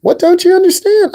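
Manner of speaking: a teacher at wit's end; exasperated. Real, not acted.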